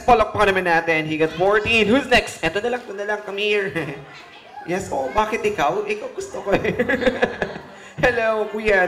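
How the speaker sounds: normal talking voice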